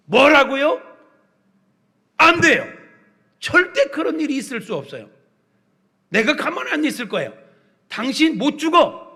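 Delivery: loud, high-pitched outburst of refusal; indignant rebuke. Acted. Peter rebuking Jesus for saying he will die, indignant shouting. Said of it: "베드로가 예수님 지금 꾸짖고 있는 거예요".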